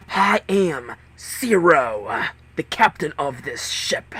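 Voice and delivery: slow, harsh masked villain; menacing announcement from a speaker; harsh captain voice. Acted. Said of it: "The voice was harsh".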